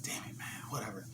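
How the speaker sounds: whispered grumble under the breath; irritated whispered grumble. Retold or imitated. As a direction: hiss it to yourself, annoyed. Irritated muttering to himself.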